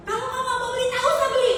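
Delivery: very high-pitched imitation of being told off; mock snapping. Market seller telling off a haggling customer, mock snapping. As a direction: mimic a brusque seller, voice jumping way up. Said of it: "Saya dibentak-bentak".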